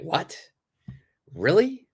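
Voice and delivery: high, rising questions of disbelief; surprised reaction. Acted. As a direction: react with astonishment, pitch shooting up. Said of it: "a natural reaction".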